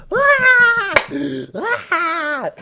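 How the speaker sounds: high-pitched shrieking cackle; loud mock laugh. Teen retelling his uncontrollable art-class laughing fit, loud hammed-up cackle. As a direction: burst out in an exaggerated, piercing laugh. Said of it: "I was laughing so hard".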